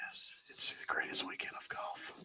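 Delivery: hushed golf announcer; soft golf broadcast whisper; golf commentator whisper. Pretend. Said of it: "I had to use my golf voice".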